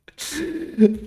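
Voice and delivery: high, loud laugh bursting out; high burst of laughter. Real, not acted. Streamer cracking up at a chat message, loud laugh. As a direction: let the laugh break free.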